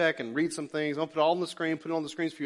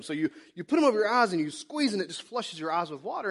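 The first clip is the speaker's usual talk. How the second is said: usual speaking voice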